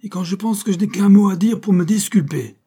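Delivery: accused man bursting out in frustration; exasperated outcry. Acted. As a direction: shout it out, pushed to the limit. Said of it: "il s'écria".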